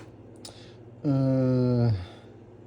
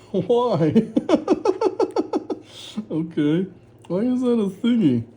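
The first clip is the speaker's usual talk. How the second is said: laughing why; 7 semitones higher than usual. Real, not acted. Sudden age question in game.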